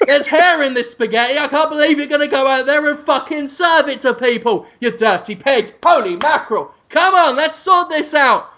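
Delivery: high-pitched, appalled chef rant; hammed-up kitchen tirade; Gordon Ramsay. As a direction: berate the cook in disgust. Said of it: "looks at it and goes".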